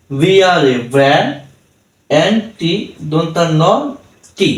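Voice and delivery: Brand spelled in separate chunks with long pauses; piece-by-piece teaching spell-out of brand; broken-up spelling. Shown as a demonstration. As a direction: stop after each part of brand. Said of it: "আমরা এভাবে না পড়ে".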